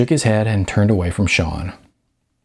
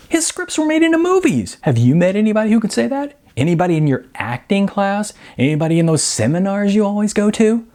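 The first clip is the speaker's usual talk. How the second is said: much higher, fast disbelieving questions; Sean incredulous; 12 semitones higher, faster than usual. A narrator's reading.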